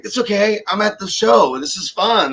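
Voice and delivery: high-pitched pep talk to self; imitated forced cheering-up; self-consoling. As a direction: talk yourself round, voice lifted. Consoling himself after goodbye.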